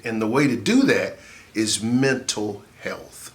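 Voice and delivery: ordinary delivery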